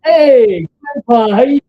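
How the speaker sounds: loud, high, cheery hello to the camera; loud greeting. Real, not acted. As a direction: call out a big friendly hello. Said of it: "Say, "Hi, Grandpa".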